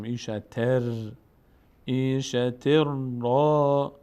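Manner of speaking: ایشتر را with the ر doubled, no ن; noon merged into ra. Shown as a demonstration. Teacher showing the tanween becoming ra, doubled ر held into را. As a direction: run ایشتر straight into a long را.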